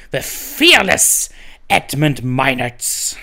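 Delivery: lightly voiced pirate captain; a hint of an old sea dog host. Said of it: "I sound like a drunk Russian".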